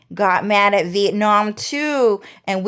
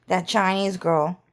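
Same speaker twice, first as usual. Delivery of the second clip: ordinary talk, nothing marked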